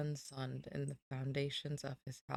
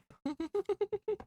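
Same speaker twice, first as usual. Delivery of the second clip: laugh; 6 dB louder than usual. Real, not acted. Laughing at own mute mistake.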